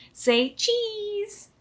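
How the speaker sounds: high, bright "say cheese"; cheery photo call. A narrator's reading. Chef posing the class for a photo, cheerful.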